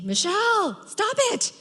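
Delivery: high, loud, urgent command; very loud call. Acted. Wishing the Lord would shout at her, very loud. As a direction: call out sharply, voice shooting way up. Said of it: "say something very loud to me".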